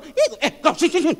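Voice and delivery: loud, high angry summons; hammed-up choir trainer snapping; mock scolding. Choir trainer calling out a bad singer, mock scolding. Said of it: "saya marahi".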